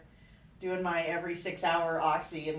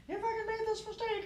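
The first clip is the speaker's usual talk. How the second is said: mock angry brother; 14 semitones higher, 6 dB quieter than usual. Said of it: "one of them's like".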